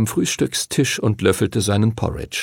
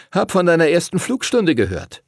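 higher-pitched impressed schoolboy; admiring older schoolboy; 8 semitones higher than usual. A narrator's reading. Older pupil praises Henry after his first flight, admiring.

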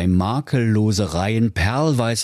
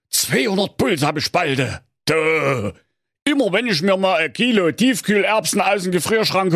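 raised, put-on grumbling tinkerer voice; muttering to himself; 6 semitones higher than usual. A narrator's reading. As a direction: grumble it to yourself in a higher voice. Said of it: "Nachdenklich murmelte er vor sich hin".

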